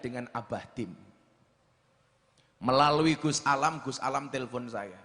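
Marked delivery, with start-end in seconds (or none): none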